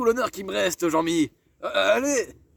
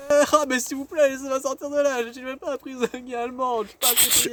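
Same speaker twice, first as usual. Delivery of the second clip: higher, whiny begging; mock pleading; 5 semitones higher than usual. Prisoner begging the guard to let him out, high and whiny.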